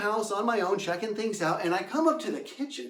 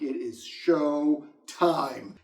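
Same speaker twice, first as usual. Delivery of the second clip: slow suspense; 4 semitones lower, much slower than usual. Retold or imitated. About to scare Paul.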